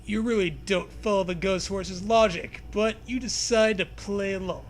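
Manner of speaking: ordinary delivery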